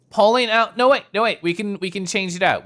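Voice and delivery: loud, high, hurried panic; panicked. Real, not acted. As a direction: yell to stop it in a hurry. Panicked stop in game.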